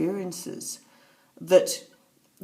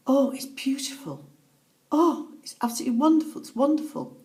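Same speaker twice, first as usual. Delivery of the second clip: lifted, wondering exclamations that fall away; retold wonder; 6 semitones higher than usual. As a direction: voice a loved one awed by what she sees. Retelling dying mother's vision of wonder.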